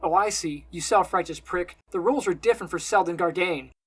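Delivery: loud, sarcastic accusation; angry shout. A narrator's reading. Simon accuses his friend of double standards, angry shout. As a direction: raise your voice and accuse him. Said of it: "Simon shouts".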